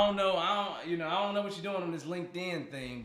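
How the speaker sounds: normal talking voice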